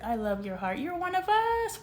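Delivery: usual speaking voice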